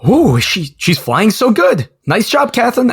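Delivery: high, admiring mock praise, slowing down; pilot's delighted praise. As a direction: gush over her flying like an impressed pilot. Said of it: "Cody's just like".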